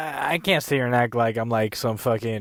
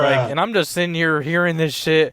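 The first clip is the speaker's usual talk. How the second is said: louder and higher, venting in disbelief; loud exasperated rant about being single; exasperated outburst; 6 semitones higher, 6 dB louder than usual. Real, not acted. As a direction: rant it out, fed up with himself.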